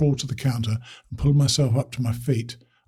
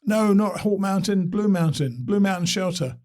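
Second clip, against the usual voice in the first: distant phone voice; 7 semitones higher than usual. Acted. Distant caller mishearing on phone.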